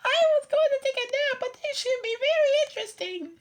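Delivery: very high, fast cat voice. A narrator's reading. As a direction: rattle it off high and quick like a prissy cat. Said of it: "what she thought".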